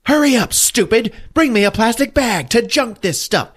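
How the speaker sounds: high-pitched yelling mother impression; mock yelling. Mother ordering her son to fetch a bag, mock yell. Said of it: "she fucking yells out".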